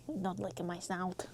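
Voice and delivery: ordinary talk, nothing marked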